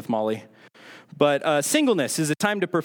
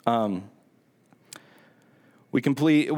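ordinary talk, nothing marked

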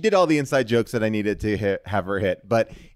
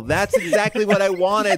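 higher and louder, thrilled; excited, much higher and louder; delighted; 7 semitones higher, 4 dB louder than usual. Real, not acted. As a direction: gush with delight at the dog voice. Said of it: "Normal woman voice".

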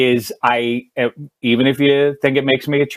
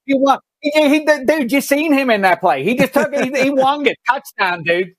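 hammed-up Pittsburgh yinzer accent, pitched higher; 8 semitones higher than usual. Host razzing the Browns to guest Dave in a hammed-up Pittsburgh accent. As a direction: ham up a high-pitched Pittsburgh accent like a die-hard Steelers fan. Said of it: "That is the Pittsburgh guy".

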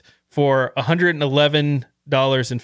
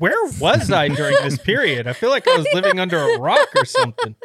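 laughing; 6 semitones higher than usual. Real, not acted. Laughing disbelief.

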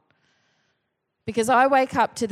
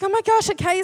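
flustered; 9 semitones higher, faster than usual. Retold or imitated. Wife flustered.

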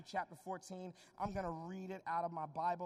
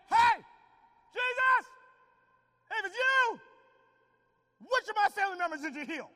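mock shout; 13 semitones higher, 12 dB louder than usual. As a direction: yell a test question. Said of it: "I mean".

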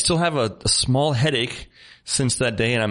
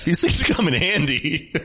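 slow, higher, laughing delivery; 6 semitones higher, slower than usual. Real, not acted. Host joking about welding masks, laughing as he talks. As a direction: say it through a laugh. Laughing through own joke.

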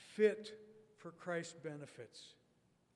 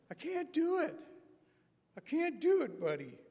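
much higher, louder, pleading refusal; Robert's high voice; 12 semitones higher, 9 dB louder than usual. Retold or imitated. Preacher voicing Robert turning down kickball, high and pleading. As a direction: squeak out the refusal shyly. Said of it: "He'd say".

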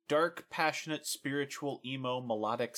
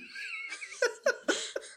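high laugh; 3 dB quieter than usual. Real, not acted. Laughs at his own joke.

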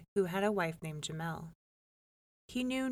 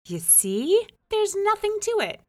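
much higher, loud, triumphant; smug wife; 13 semitones higher, 8 dB louder than usual. A narrator's reading. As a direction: crow at the husband.

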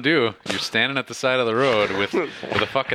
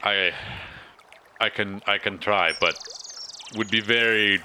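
put-on hesitant, Russian-sounding accent; Austrian turning Russian accent; 2 semitones lower than usual. Hostage hedging about the festival, Russian-tinged accent. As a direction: let the fake Austrian accent slide into Russian. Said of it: "it's getting more and more Russian".

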